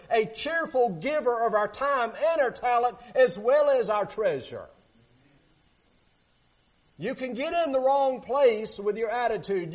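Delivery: high, loud preaching build; preaching at full force. Acted. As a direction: urge the whole congregation, full voice.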